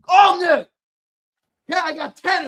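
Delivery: high, loud, insistent; indignant shout. Real, not acted. Streamer defending his new cables to chat, indignant shout. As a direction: bark it back, offended.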